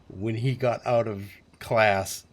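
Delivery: ordinary delivery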